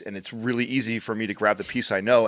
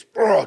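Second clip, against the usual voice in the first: imitated rush noise; 12 semitones higher, 7 dB louder than usual. Kids taking off running.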